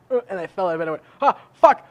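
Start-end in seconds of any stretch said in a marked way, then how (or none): 1.2-1.9 a loud, high yelp of pain as he falls; sudden high shout of pain; yelled curse. Retold or imitated. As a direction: shout it like you just hit the floor. Retells falling out of bed.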